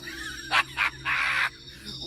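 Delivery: shrill, breathless cosmic giggle; manic laugh. Acted. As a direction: laugh like a giddy madman.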